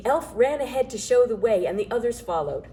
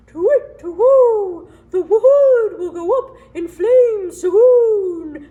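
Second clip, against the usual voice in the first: slow, higher and much louder hooting owl; 12 semitones higher, 12 dB louder, much slower than usual. A narrator's reading. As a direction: hoot it out like an owl. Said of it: "said the owl".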